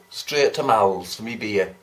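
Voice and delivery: ordinary talk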